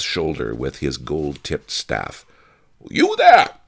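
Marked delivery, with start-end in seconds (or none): 2.9-3.5 high, very loud hail; bellowing. A narrator's reading. Major domo raps the miser's shoulder, bellowing. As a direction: bellow it to grab someone's attention. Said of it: "he bellowed".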